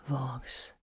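soft whispered name. A narrator's reading. Titus to exhausted Vox, whispered name. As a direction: breathe her name gently. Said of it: "Titus whispered".

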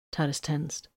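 ordinary delivery

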